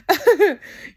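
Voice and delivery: quick high burst of laughter; real laugh. Real, not acted. Laughing mid rant.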